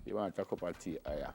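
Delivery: soft Jamaican patois lilt. Shown as a demonstration. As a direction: drop into patois, easygoing. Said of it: "And in patois, me just say".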